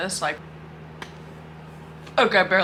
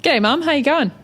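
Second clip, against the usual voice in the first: higher, hammed-up Australian greeting; put-on Aussie accent; 8 semitones higher than usual. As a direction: greet Mum as a full-on Aussie. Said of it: "go as full Aussie as I can".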